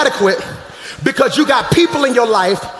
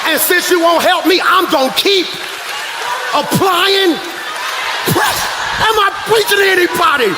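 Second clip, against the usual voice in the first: rising higher, preaching harder; building preach; 6 semitones higher than usual. Acted. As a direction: climb into full preaching.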